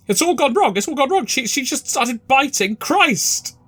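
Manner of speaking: a terrified follower blurting out the news; panicked cultist. Acted. Fleeing cultist telling Crowley what happened, panicked.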